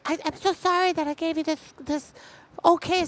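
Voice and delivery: high falsetto, apologetic wife; wife's voice. Retold or imitated. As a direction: go way up, sheepish and sorry.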